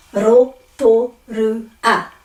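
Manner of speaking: Rotorua very slowly in four syllables, ro-to-ru-a; slow syllable-by-syllable Rotorua with tapped r; broken at vowels. Shown as a demonstration. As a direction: break Rotorua up after every vowel. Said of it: "but this is".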